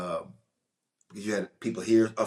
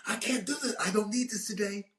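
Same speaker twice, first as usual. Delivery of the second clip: high mock-exasperated outburst, pitched way up; imitating Gary Oldman; 12 semitones higher than usual. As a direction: throw up your hands in a high, fed-up voice. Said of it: "He goes".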